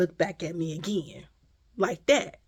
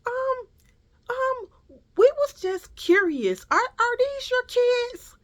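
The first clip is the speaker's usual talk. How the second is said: very high, slow, hesitant voice; imitated second woman; 4 dB louder, slower than usual. Second shopper curious about a mother's kids, imitated hesitantly. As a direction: mimic her cautiously asking, pitched way up. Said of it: "she was like".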